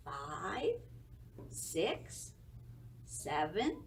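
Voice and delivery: usual speaking voice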